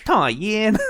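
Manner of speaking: high, loud "Tai Yin"; sulky cartoon cry; Chinese Swiper. Imitating Mandarin Swiper's loud sulky cry for viewers. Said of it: "he goes".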